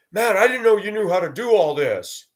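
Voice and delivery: a touch of impressed surprise; mock amazement. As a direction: sound like a coworker amazed by his house. Coworkers' shocked remark.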